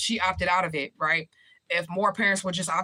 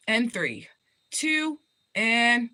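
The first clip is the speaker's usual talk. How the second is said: playful higher countdown like a studio producer; playing a producer counting in the broadcast, higher mock countdown; 5 semitones higher, much slower than usual. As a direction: count down to going live, hammed up.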